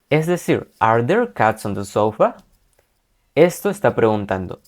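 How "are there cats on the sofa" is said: "Are there cats on the sofa?" ending on an upward lift; rising question tune. Shown as a demonstration. Teacher modelling question intonation for the class. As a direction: let "sofa?" climb to mark the question. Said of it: "con una entonación acabando hacia arriba".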